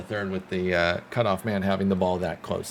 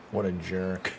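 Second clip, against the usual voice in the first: quiet, lower mock grumble at the fielder; 3 semitones lower, 5 dB quieter than usual. Annoyed fan jokingly insulting the fielder, quiet grumble. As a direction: mutter it as a joking jab. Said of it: "No, that's a great play".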